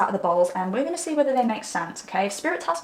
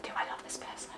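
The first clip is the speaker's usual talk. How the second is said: whispered private doubt; 15 dB quieter, faster than usual. Acted. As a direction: whisper the question to yourself. Inner doubt voiced as thought.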